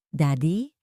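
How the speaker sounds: a faint, hoarse little call; barely voiced, a touch of rasp, like a sick child waking; weak hoarse voice. A narrator's reading. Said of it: "Isang mahina at paos na boses".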